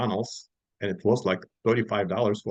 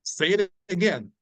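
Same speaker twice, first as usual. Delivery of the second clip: higher, challenging demand; mock angry; 8 semitones higher than usual. To the customer asking for babushka, mock angry. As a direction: dare him to repeat it. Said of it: "really angry".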